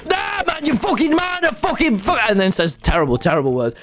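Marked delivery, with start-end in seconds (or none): 0.0-2.2 high mock road-rage rant; imitating raging driver. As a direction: squeal it like a furious driver losing it. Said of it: "he is a rage".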